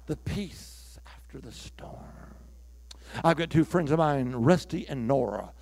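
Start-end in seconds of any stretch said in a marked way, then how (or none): 0.1-2.3 whisper. Acted. Hushed line after the storm.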